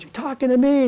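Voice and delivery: high-pitched tough-guy challenge in imitation; De Niro. Reporter turning on the president, mock tough-guy challenge. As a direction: toss it back as a mock challenge. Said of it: "gives him a De Niro look".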